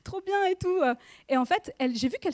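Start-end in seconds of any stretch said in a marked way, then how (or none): 0.0-0.8 light laugh through the words, pitched up; laughing it off as if at a joke; amused chuckling reply; retold laughing.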